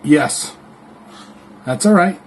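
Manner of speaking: loud reply to someone offstage; yelling across the room. Real, not acted. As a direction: shout it to the next room. Calling back to mom.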